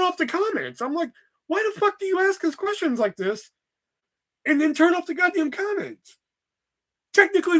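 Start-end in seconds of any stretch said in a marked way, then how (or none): 1.5-6.2 high-pitched, exasperated demand; exasperated complaint. Retold or imitated. Streamer complaining to a model who blocks replies, exasperated. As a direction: demand an explanation, pitch pushed way up.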